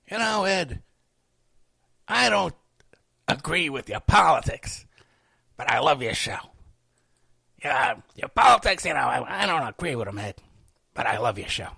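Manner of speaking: mock admirer voice, lightly overdone; silly put-on fan voice. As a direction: ham up the fan who disagrees but loves the show. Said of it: "They don't say it in that voice".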